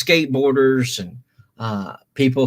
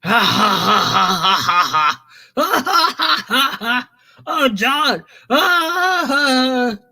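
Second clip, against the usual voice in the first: imitating a big laugh; 11 semitones higher than usual. Retelling the editor laughing hard at the pitch, high mock laughter. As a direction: act out the editor cracking up. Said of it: "started laughing his ass off".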